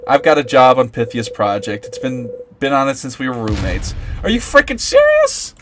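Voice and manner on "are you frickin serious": very high disbelieving yell; furious high squeal of disbelief; outraged shriek. Acted. Outraged at roommate's secret.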